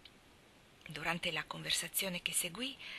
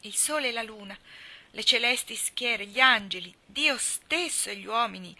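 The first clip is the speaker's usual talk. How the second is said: loud theatrical tragic declamation; building, ringing stage oath; forceful cry; 3 semitones higher, 7 dB louder than usual. A narrator's reading. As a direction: invoke the heavens at full force. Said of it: "gridando con gran forza".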